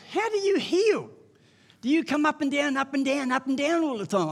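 put-on high, puzzled childlike asking; naive young believer. Preacher quoting his childhood prayer to Jesus, high and puzzled.

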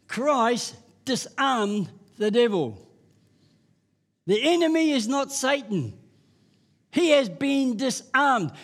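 slow, high and firm; emphatic preaching. Acted. Preacher declaring victory to the crowd, slow and firm. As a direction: drive each word home.

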